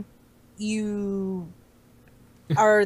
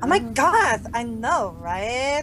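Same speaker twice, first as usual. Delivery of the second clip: higher, hammed-up gushing voice; gushing parody; 4 semitones higher than usual. Joking with friends after pedantry jibe, hammed-up gushing. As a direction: exaggerate each phrase with lifted endings.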